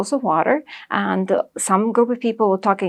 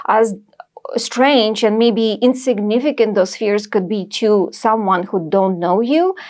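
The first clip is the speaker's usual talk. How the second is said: ordinary delivery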